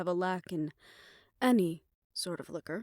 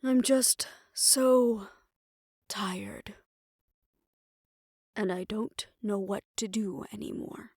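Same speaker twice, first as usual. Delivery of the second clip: weary confession. Acted. Worn-out fixer confessing to a confidant, weary and defeated. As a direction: run out of steam, worn down and lost.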